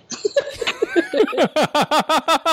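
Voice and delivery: bursting into real laughter; high real laugh after an embarrassing confession. Real, not acted.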